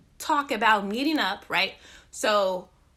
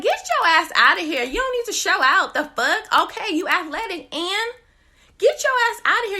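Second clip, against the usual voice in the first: higher, louder scolding shout; mock yell; 6 semitones higher, 5 dB louder than usual. Tired hiker yelling at runners on the stairs, mock scolding. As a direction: shoo the show-offs away.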